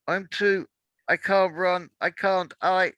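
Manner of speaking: high, broken, faltering protest; fearful pleading. Acted. Omrose telling Awa she cannot run, high frightened stammer. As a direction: stammer in panic and exhaustion. Exhausted mistress refuses to run.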